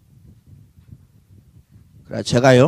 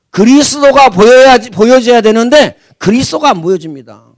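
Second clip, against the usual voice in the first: forceful preaching; 3 semitones higher, 6 dB louder than usual. Acted. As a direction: drive each phrase home to the congregation. Preacher builds to climax. (힘 있게 외치는 설교)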